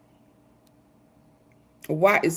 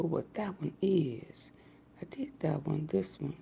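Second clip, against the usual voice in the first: whispered; 3 semitones lower, 16 dB quieter than usual. Real, not acted. Muttering while picking video.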